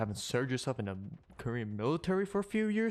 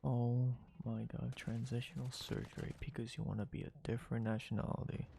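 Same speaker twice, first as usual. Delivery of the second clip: quiet incredulous aside; stunned disbelief; 8 dB quieter than usual. Real, not acted. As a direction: say it almost to yourself, floored. Stunned by the surgery claim.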